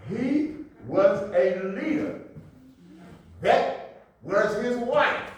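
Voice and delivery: slow, spaced-out words with weight on LEADER and WIFE; deliberate emphasis. Shown as a demonstration. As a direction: drive leader and wife home slowly. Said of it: "I'm emphasizing for a reason".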